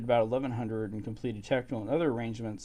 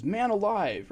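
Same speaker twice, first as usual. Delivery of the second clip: higher, startled gasp of a line; retelling her shock, pitched up; shocked exclamation; 9 semitones higher, 3 dB louder, slower than usual. Retold or imitated. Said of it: "And then she says".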